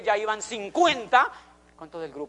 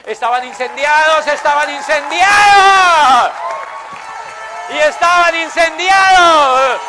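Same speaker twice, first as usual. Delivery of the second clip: louder and louder, slow and stretched, shouting the crowd up; rising rousing shout; 8 semitones higher, 15 dB louder, much slower than usual. Real, not acted. Rallying the convention crowd, shouting they were on fire. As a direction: build to a full shout, stretch each word.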